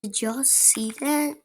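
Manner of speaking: ordinary delivery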